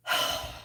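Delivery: long voiceless exhale; calming sigh. Real, not acted. Crafter trying to calm herself after a mistake, calming sigh. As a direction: sigh it out to settle yourself. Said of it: "I'm gonna calm myself".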